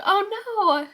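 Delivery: sudden high "oh no" as tears rise; high, choked-up exclamation; emotional yelp. Real, not acted. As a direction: catch yourself tearing up. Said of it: "I'm getting emotional".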